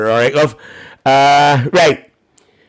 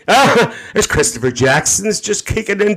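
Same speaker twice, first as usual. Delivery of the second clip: ordinary delivery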